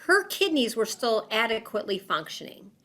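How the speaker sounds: usual speaking voice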